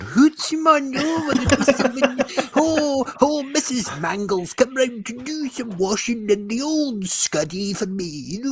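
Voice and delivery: hammed-up high Scottish accent, very slow; mock Scottish accent. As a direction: camp Scottish impression, dragged out. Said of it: "he puts on this accent".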